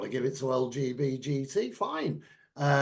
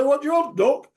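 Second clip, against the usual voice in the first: raised, loud, familiar shop greeting; mock shop assistant; 10 semitones higher, 8 dB louder than usual. Waitrose staff addressing a customer, mock blunt greeting. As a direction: call it out like a down-to-earth assistant.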